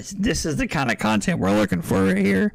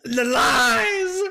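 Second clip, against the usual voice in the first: mock anguish; 5 semitones higher, much slower than usual. Streamer to chat about the fake water, hammed-up anguished wail. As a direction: wail it like a betrayed tragic hero. Mock betrayal over the drink.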